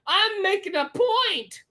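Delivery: ordinary talk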